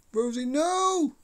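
high, slow drawn-out no; horrified cry. Real, not acted. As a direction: cry out in horror. Partner about to dive.

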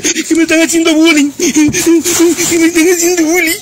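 high, whiny fake sobbing voice; mock her as a crybaby, whimpering high; mock crying whine. Mocking her crying about bullying. (burlón llanto fingido, voz aguda y quejumbrosa)

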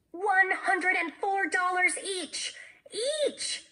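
high, slow, drawn-out triumphant announcement; excited shout. A narrator's reading. Jessie announcing their earnings to her friend, excited shout. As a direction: call out the total high and slowly, stretching it. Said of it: "shouted Jessie".

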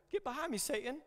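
playful sudden rebuke; mock abrupt rebuke. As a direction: flip to a sharp rebuke. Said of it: "And then shortly after".

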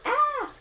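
high startled yelp. Real, not acted. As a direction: yelp in surprise. Sudden high cry.

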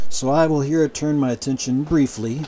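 normal talking voice

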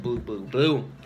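lightly voiced mumbling buddy; mocking imitation. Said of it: "He said".

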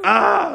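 imitated high, loud yell; real loud "ah". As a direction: mimic a child's loud high cry. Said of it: "like, real loud".

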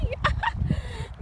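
high delighted burst of laughter; real happy laugh as the horse comes. Real, not acted. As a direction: laugh with relief and joy.

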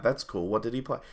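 ordinary delivery

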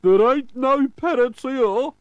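high, loud, slow pirate voice; pirate crewman. A narrator's reading. Pirate disputes the clue, high loud character voice. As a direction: squawk it out as a crewman.

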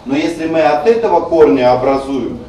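ordinary talk

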